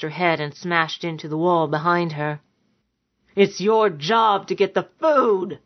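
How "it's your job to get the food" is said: angry roar held at speaking volume; mild roar. A narrator's reading. Bully demanding food from his lover, mildly roaring. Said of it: "Siernan roared".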